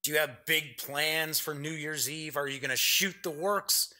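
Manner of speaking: normal talking voice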